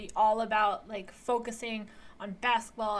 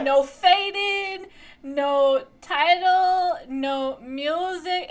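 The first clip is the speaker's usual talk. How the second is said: slow incredulous emphasis; 5 semitones higher, 6 dB louder, much slower than usual. Real, not acted. Incredulous list of what's missing.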